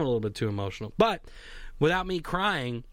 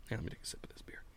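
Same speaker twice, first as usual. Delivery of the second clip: whispered aside; 5 semitones lower, 17 dB quieter, much faster than usual. Real, not acted. Aside before sipping beer.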